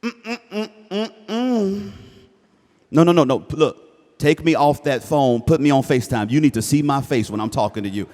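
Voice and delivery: loud scolding parent act; hammed-up parent demanding FaceTime; playful loud parental lecture; mock outraged parent. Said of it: "don't respond".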